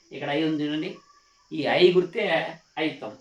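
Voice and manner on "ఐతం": normal talking voice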